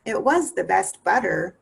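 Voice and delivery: plain reading voice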